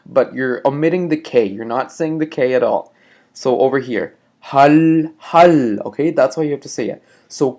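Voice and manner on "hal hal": hal said like Hulk without the K. Shown as a demonstration. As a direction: drop the K: hal. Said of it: "almost like saying Hulk".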